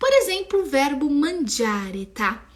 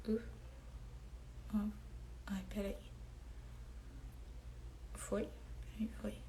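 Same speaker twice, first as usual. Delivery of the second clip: whisper; 3 semitones lower, 21 dB quieter, faster than usual. Real, not acted. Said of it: "muttering to herself while writing".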